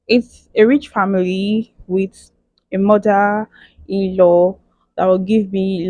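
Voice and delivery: normal talking voice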